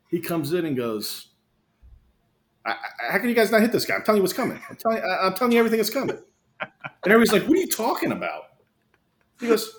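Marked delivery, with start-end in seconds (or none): 2.7-6.2 high-pitched, incredulous complaint; exasperated coach. Retold or imitated. Imitating an exasperated coach in a story. 7.5-8.4 baffled players. Retold or imitated. Acting out baffled players' reply.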